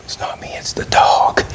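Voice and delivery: hushed whisper, pinning it on the dog; whispered excuse. Retold or imitated. Husband whispers his excuse to his wife. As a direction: whisper it like a guilty secret.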